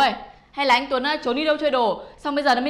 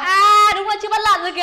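high, loud exclamation; amazed recognition; 6 semitones higher, 6 dB louder than usual. Acted. Woman recognizing the pig deity, amazed recognition. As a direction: cry out on spotting who he is.

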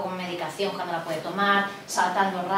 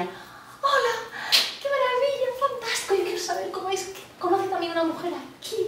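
mock gushing; 12 semitones higher than usual. Mocking gushing greeting.

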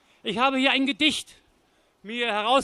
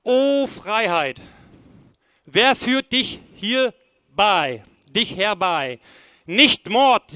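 slow, solemn poem with measured pauses; poem recitation; 4 dB louder, slower than usual. Acted. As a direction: recite each verse deliberately, letting the lines fall at the end. Recites first poem aloud.

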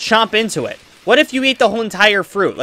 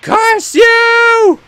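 villain's outburst; 7 dB louder than usual. Retold or imitated. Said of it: "And he's just like".

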